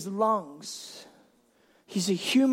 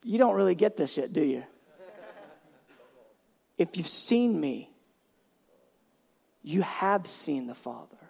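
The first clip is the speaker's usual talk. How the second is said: gentle Jesus; 7 semitones lower, 7 dB quieter than usual. Retold or imitated. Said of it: "And Jesus just goes".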